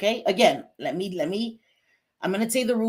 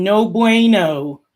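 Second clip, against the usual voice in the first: mock disapproval; 2 semitones higher, 9 dB louder, much slower than usual. Drawn-out dismissal of viewer.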